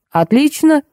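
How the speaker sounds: high, upbeat exclamation; lifted voice of relief; pleased exclamation. A narrator's reading. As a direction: exclaim it with acted delight. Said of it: "воскликнул Дегтярев".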